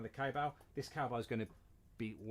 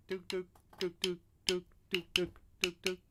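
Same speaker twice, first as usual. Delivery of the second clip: high squeaky dook dook; ferret voice; 7 semitones higher, 4 dB louder, slower than usual. Pretend. Vocal cowbell sound for Brian.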